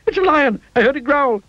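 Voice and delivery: high, squealing alarm; terrified shriek. A narrator's reading. Mister Jelly takes snoring for a lion, terrified shriek. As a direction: shriek a warning in terror. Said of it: "shrieked Mister Jelly".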